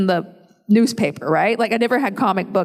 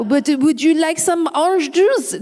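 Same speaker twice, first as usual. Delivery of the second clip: hammed-up, deliberately bad French accent; 5 semitones higher than usual. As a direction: tease a child in mock French. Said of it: "I do some really bad accents".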